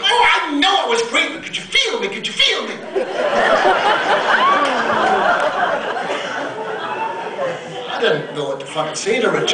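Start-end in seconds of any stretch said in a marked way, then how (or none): 0.0-2.8 high, excited flamboyant squeal. Retold or imitated. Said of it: "He goes". 2.8-7.8 high whooping cackle; flamboyant whooping laugh. Retold or imitated. [name] impression laugh.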